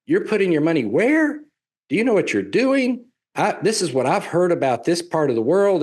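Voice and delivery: high, alarmed disbelief; high incredulous family doubt; incredulous relative. Retold or imitated. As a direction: play the shocked cousin questioning the money. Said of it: "and they go".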